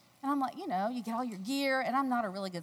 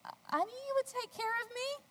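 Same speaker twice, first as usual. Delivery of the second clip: high sweet innocent; 14 semitones higher, 3 dB quieter than usual. Retold or imitated. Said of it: "I'm like".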